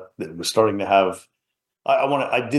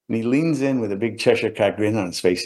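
usual speaking voice

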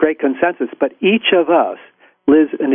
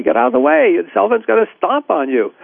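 high pitched warning yell, much higher; retold crowd yell; 7 semitones higher than usual. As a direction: shout the warning up high as the elephant charges. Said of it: "everybody's screaming on the side".

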